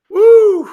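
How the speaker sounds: sudden high-pitched woo; loud high whoop. Real, not acted. Whoop on coming back on.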